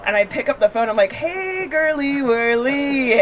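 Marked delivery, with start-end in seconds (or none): 1.1-3.2 slow, sing-song sweet voice; mock sweet greeting. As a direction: coo it slowly and sweetly. Fake sweet greeting to mom.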